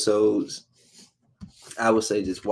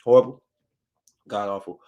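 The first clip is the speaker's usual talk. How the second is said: loud verdict; 5 dB louder, faster than usual. Real, not acted. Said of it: "I just wanna say that one more time".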